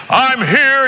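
loud, high put-on announcing; big preacher voice. Guest speaker getting up before the crowd, big put-on voice. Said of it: "he would have this voice".

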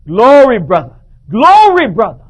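loud, high joyful shout of praise; grinning pastor yelling glory at a fellow preacher; beaming shout. Retold or imitated. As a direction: bellow it with a huge grin. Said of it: "Smiling goes".

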